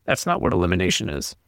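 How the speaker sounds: put-on low, grumbling teen retort; sulky teen. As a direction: mutter it back like a sullen teen. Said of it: "And he goes".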